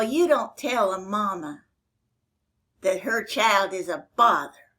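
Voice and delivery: usual speaking voice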